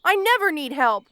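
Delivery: ordinary talk, nothing marked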